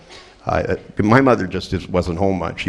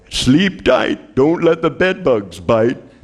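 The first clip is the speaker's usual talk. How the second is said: slow, higher sing-song goodnight; parent's bedtime line; 5 semitones higher, much slower than usual. Retold or imitated. Parent tucking child in, slow sing-song goodnight. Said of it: "they go".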